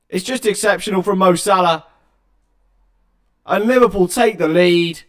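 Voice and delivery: loud, high-pitched commentator's roar; excited goal call. Real, not acted. Commentator tells fans Mo Salah has scored, excited shout. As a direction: belt out the goal at full volume.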